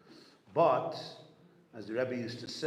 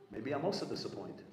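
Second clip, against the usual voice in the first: usual speaking voice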